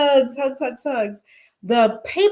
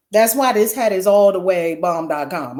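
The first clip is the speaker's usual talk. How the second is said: normal talking voice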